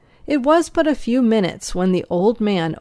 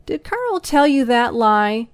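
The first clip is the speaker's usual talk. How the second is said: demanding; 4 semitones higher than usual. A narrator's reading. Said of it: "I demanded".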